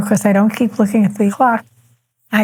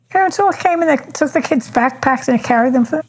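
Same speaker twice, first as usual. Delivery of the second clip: ordinary talk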